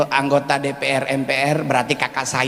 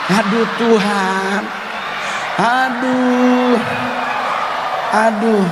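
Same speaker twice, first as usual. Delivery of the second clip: pained comic groan; 6 semitones higher, slower than usual. Acted. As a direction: wail it like a comic disaster. Comic mortification over koteka.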